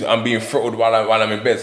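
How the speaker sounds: normal talking voice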